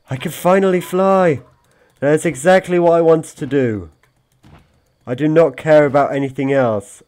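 playful triumphant flier; excited triumph. Pretend. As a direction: shout with joy.

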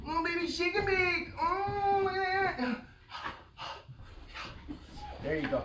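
doting owner cooing at a dog. Real, not acted. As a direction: coo at the pup in a very high voice. Cooing at dog during training.

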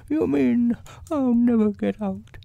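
high, worried bear voice; storybook bear, pitched high and fretful; Pooh. A narrator's reading. Pooh asking if he is stuck forever, high voice.

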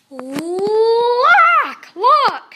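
high, loud, first word stretched long; big rising whoop on "Look"; excited shout. A narrator's reading. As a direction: yell it like thrilled cubs. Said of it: "shouted the cubs once again".

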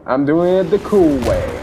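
ordinary talk

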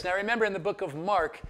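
normal talking voice

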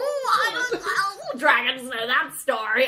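high, loud, drawn-out comic dodge; laughing, hammed-up bashful voice; mock sheepish dragon. Dragon dodges Bowen's question, scratching his head, hammed-up sheepish. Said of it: "scratches the back of his head. He's like".

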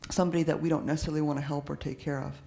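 ordinary talk

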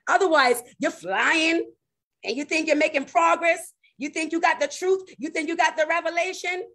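high, insistent preacher's build; impassioned preaching. Real, not acted. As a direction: preach it high, each phrase held up in the air. Preaches at raised pitch.

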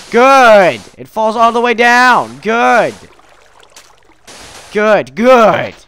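loud, high, furious approval; exasperated sarcastic bellow; sarcastic shouting. Real, not acted. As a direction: yell praise through frustration. Sarcastic as sand falls down.

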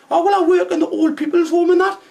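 very high mock girl voice; imitating Scruffy Lisa. Taxi driver mimicking his passenger in a very high mock voice. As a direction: squeak it out as the lass. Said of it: "she went".

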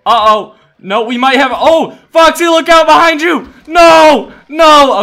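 very high, very loud yell; panicked warning shout. Real, not acted. Player warning Foxy of attack from behind, panicked shout. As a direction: scream a warning to a teammate.

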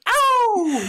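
high, loud "oh" howl; playful howl. Pretend. Co-host answering the Wolfpack intro, playful howl.

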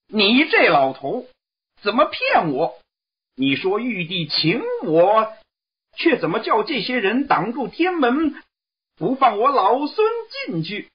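high, indignant monkey voice scolding; displeased. Acted. Monkey King scolding the envoy for being blocked, high indignant voice. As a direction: accuse the old man, high and huffy. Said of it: "悟空不高兴地说".

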